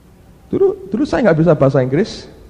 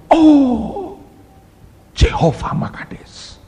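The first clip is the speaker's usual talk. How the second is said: awed cry; 11 semitones higher, much slower than usual. Retold or imitated. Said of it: "engkau teriak".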